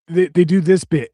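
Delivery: usual speaking voice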